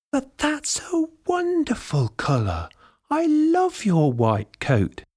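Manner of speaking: high, admiring granny voice; delighted old lady. A narrator's reading. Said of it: "the old lady replied".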